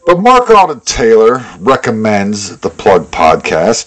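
ordinary talk

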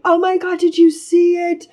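high-pitched mock Russian woman; attempted Russian accent. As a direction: imitate a freaked-out Russian woman, accent badly faked. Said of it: "I can't do a Russian accent".

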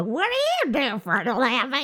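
hammed-up nosy question; nosy stranger. Co-host mimics someone asking about your job, intrusive mock question. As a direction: mimic a rude, prying stranger.

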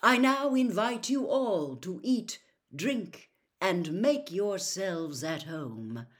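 put-on a genial old headmaster calls the feast open; grand invitation. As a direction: open your arms to the whole hall. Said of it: "said Dumbledore".

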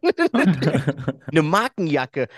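laughing, drawn-out and slow. Real, not acted. Laughing in amazement that his brand jacket was still there. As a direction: laugh through the words.

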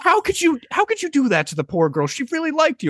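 high, appalled scolding; mock reproach. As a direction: imitate an indignant friend's wife. Friend's wife reproaches him in story.

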